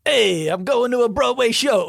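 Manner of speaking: loud, high mock New York accent. Shown as a demonstration. Asked by co-host to do New York, hammed-up New York accent. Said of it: "Do a New York accent".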